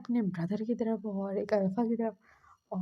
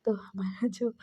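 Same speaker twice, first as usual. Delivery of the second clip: usual speaking voice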